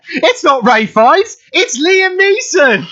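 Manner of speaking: high, slow, drawn-out mock revelation; hammed-up amazement. Old lady realising who really signed, hammed-up amazement. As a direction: stretch out the pretend astonishment. Acts out lady's discovery, laughing.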